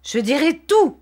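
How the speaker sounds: high, loud outburst of despair; desperate cry. A narrator's reading. Wickfield threatening to tell everything, crying out in despair. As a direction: cry it out loud, pitched far up. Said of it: "s'écria monsieur Wickfield d'un air désespéré".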